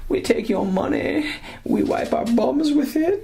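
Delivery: very high put-on squeal; gleeful, hammed-up taunt in falsetto; mock the paying customers; mock company voice. Mocking company's voice to paying fans.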